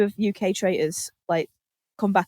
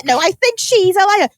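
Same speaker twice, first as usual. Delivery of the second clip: higher, louder hammed-up rebuttal; mock angry retort; 9 semitones higher, 5 dB louder than usual. Contestants arguing at the round table, mock angry retort. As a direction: throw the blame on someone else. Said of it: "different accents shouting angrily".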